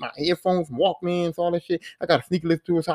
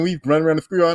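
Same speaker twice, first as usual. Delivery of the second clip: ordinary delivery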